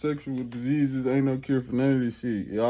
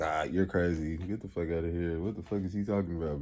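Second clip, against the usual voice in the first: lower, softer scoffing; mocking doubters; 4 semitones lower, 8 dB quieter than usual. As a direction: brush him off as a quack. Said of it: "be like".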